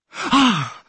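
sharp high gasp; gasp for air. A narrator's reading. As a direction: gulp air as you surface. Breaking the surface.

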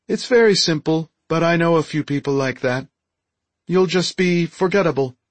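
high alien creature voice; high-voiced Deltan, approving. Deltan inspects the improved android, high approving voice.

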